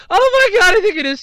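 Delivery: high, loud burst of glee; thrilled gasp of disbelief; excited. Real, not acted. Checking if the doll sold out, excited.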